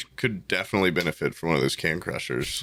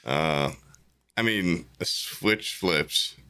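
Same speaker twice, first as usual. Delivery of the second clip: hungover; 6 semitones lower than usual. Real, not acted. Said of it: "I'm still hungover as shit right now".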